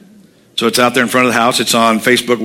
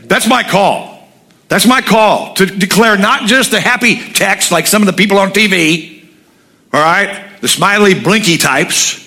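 higher, fervent preaching; 8 semitones higher, slower than usual. Acted. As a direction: preach it with conviction. Preaching full force.

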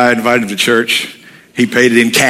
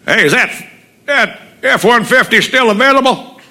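much higher, eager caller's voice; eager buyer; 11 semitones higher than usual. Retold or imitated. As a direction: play the buyer calling in keen. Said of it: "phone call".

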